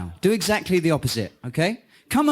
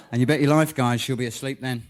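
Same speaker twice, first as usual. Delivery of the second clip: ordinary talk, nothing marked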